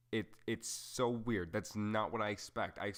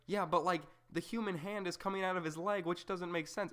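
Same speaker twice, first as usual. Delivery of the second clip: much higher, incredulous mock objection; a pitched-up skeptic pointing out the flaw; 10 semitones higher than usual. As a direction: push it up high in disbelief. Voicing the objector, high.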